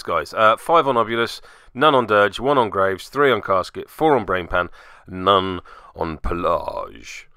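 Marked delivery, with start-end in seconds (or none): 5.1-7.3 Pelage drawn out slowly, relishing the French-sounding pəlɑʒ; savouring Pelage. Shown as a demonstration. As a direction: linger over "Pelage", enjoying the sound of the word. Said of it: "Hmm, it's fun to say".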